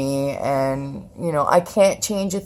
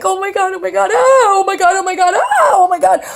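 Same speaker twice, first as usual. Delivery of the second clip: high, squealing oh my God; overwhelmed happy squeal; giddy panic; 13 semitones higher, 4 dB louder, faster than usual. Retold or imitated. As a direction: freak out with nervous joy. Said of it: "happy as shit".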